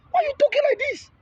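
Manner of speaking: high, fast incredulous squeak; high fast startled disbelief; mock melancholic. Voicing a quiet melancholic's reaction.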